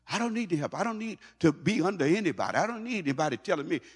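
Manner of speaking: high-pitched imitation of stubborn independence; whiny-high protest, played for mockery; mock self-sufficiency. Voicing independent people who refuse any help, mock defiant.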